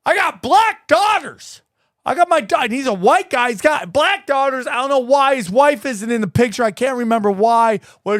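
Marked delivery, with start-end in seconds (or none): 0.1-2.6 high-pitched, excited mock fan; worked-up fan. Host imitating an excited fan at Starbucks, worked up. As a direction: imitate a fan getting all ratcheted up. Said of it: "he's getting excited".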